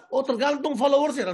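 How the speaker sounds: hammed-up eager listener; mock gullible excitement. Preacher mocking a gullible listener, gushing loudly.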